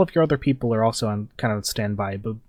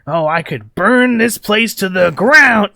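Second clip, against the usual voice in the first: louder, higher, slow gloating threat; villain's roar; 9 semitones higher, 10 dB louder, slower than usual. Acted. Gang leader roars his threat at the defenders, slow and loud. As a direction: bellow the threat over the barricade. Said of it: "he's like".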